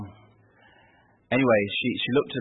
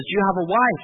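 higher pleading nurse voice; eager nurse; 7 semitones higher than usual. Retold or imitated. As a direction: beg like a hopeful nurse.

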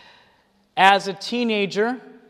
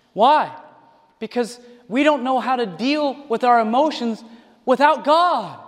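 ordinary delivery

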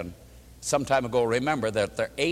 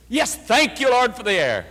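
higher, louder "thank you, Lord" burst; louder second thanks; 8 semitones higher, 5 dB louder than usual. Shown as a demonstration. Pastor shows the second slice of the sandwich, louder. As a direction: ring out the thanks with fresh force. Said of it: "the second".

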